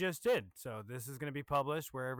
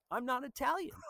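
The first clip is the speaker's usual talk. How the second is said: exasperated protest; 9 semitones higher than usual. Retold or imitated. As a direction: insist you're not Italian, half shouting. Insisting to disbelieving friend.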